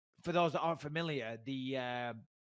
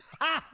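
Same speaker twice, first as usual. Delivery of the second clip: high burst of laughter; 13 semitones higher than usual. Real, not acted. Reacts to silly voice.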